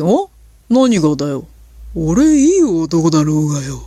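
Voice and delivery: smug manly voice to win the argument; faked handsome voice. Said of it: "みたいな感じですごいいい声で".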